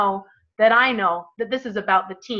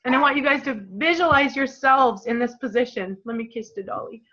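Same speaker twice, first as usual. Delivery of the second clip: normal talking voice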